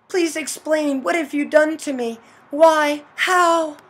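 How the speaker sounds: insistent plea ending on loud one-word questions; pleading heroine. Acted. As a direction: act a betrayed victim demanding an explanation. Heroine pleads with her mother.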